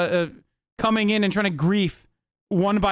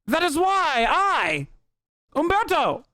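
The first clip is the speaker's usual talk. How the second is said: high-pitched villain with a hammed-up transatlantic accent; put-on transatlantic supervillain, pitched way up; Umberto, cartoonish villain; 11 semitones higher than usual. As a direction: crow it like a moustache-twirling cartoon baddie. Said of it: "why he has a transatlantic accent".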